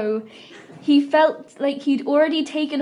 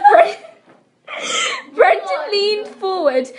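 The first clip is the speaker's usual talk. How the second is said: higher, slow, laughing till tearful; breaking up laughing; 13 semitones higher, 4 dB louder, slower than usual. Real, not acted. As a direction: laugh helplessly mid-word.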